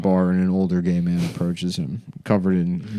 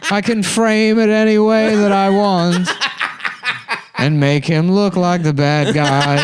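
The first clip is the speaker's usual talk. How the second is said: put-on high, louder, laughing old man; smug, giggling old man scheme; 6 semitones higher, 3 dB louder, slower than usual.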